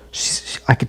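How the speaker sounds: breathy confiding whisper. Retold or imitated. Whispering to Mark to coax him. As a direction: lean in and whisper it.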